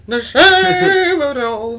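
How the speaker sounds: high, loud, slow theatrical lament; over-the-top mock despair; melodramatic. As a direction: ham up the tragedy. Mock dramatic announcement.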